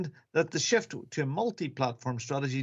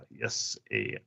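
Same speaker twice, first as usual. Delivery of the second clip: put-on Japanese accent; 4 semitones lower than usual. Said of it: "and Japanese".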